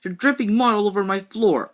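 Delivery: loud high scolding; high, loud complaint. Acted. Boy scolding his dripping brother, high and loud. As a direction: yell it at him, high.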